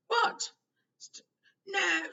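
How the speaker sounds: high, loud stammered protest; stuttering. A narrator's reading. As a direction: stammer it out in panic. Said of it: "stuttered Fudge".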